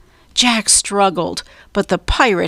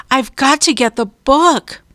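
higher, insistent call; 6 semitones higher than usual. A narrator's reading. Jack explains to Annie from below, insistent raised call. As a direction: call back with determination. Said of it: "Jack called".

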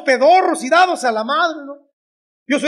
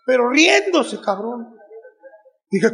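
ordinary delivery

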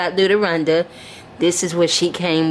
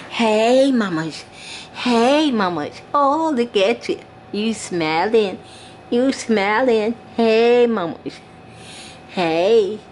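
higher-pitched, drawn-out cooing; doll baby talk; 4 semitones higher, slower than usual. Pretend. Mother greeting her little doll, cooing baby talk. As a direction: greet a baby in a sweet singsong.

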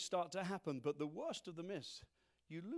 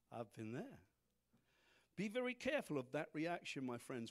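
ordinary delivery

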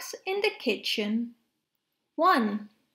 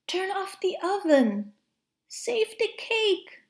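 alarmed cry; 7 semitones higher than usual. Acted. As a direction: cry out to rescue the cake. Cake drips, monkey panics.

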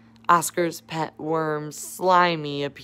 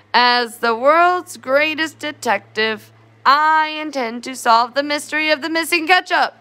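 detective voice; 6 semitones higher than usual. Acted.

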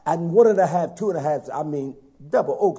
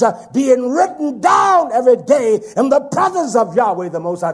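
loud, high, slow thundering sermon; full-force preaching; 9 semitones higher, 10 dB louder than usual. Acted. Preacher warning God's people of sin, at full force.

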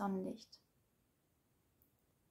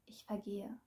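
fading whisper; 11 dB quieter than usual. Acted. Poet fading away in her poem, whispering. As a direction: whisper it, voice nearly gone. Repeat of line 42, dying away.

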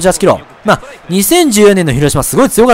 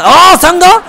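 high, loud yelp of shock; startled loud cry; 12 semitones higher, 5 dB louder, slower than usual. Real, not acted. Player startled mid double play, loud cry. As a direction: burst out in sudden alarm. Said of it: "大きな声出してびっくりさせて".

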